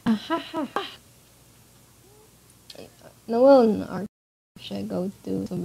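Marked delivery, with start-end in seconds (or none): 0.1-0.9 high, staged "ah ha ha"; theatrical laugh. Acted. Player voices a gloating character, theatrical laugh. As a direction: act out a high mock laugh.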